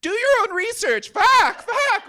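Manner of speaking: high, shouted retort and cursing; hammed-up exasperated yelling; uncontrolled outburst. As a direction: blurt it loudly as if it burst out of you. Compulsive outburst at crying niece.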